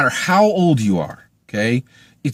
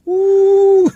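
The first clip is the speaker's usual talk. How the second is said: a sudden squealed ooh, much higher and louder; high mock ooh; 6 dB louder than usual. Real, not acted. Reviewer hooting at the hero leaving his love with a high ooh. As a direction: squeal it like you can't believe it. Reacting to boy leaving Fatima.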